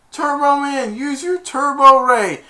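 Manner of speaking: high, loud plea to a hero; imitated movie cry for action; urgent call. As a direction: call on the superhero to act. Acting plea to superhero.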